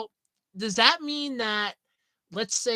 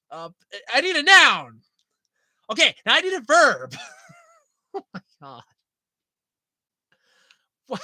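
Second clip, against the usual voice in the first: usual speaking voice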